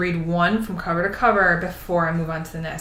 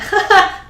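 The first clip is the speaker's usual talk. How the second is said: laugh; 12 semitones higher, 8 dB louder than usual. Real, not acted. Delight at pink page.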